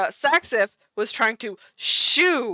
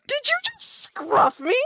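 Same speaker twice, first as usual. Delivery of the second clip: outraged disbelief. Acted. Cat character indignant at being scruffed.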